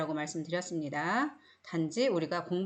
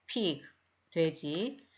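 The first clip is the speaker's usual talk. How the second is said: usual speaking voice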